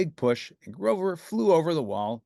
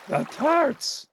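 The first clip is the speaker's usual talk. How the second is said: high, alarmed cry; 4 dB louder than usual. A narrator's reading. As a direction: panic for the tarts. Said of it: "cried Grover".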